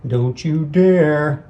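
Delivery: slow, deliberate warning. Acted. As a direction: draw out each word as a threat. Character line, very slow.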